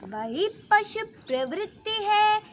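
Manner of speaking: slow, loud, ringing declamation; forceful poem recital. Acted. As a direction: declaim every line like a battle cry.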